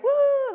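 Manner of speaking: high, loud whoop of fun; mock cheer of kids on the zip line; imitated woo. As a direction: whoop like the kids flying over. Kids zip lining over lake.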